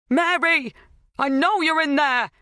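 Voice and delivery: high, loud shout; urgent high-pitched demand; crying out. A narrator's reading. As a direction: cry out to someone behind a door. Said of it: "Helen cried".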